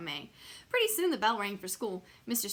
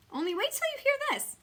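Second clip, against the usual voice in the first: excited boast; 4 dB louder than usual. Acted. Lenny's boast in story.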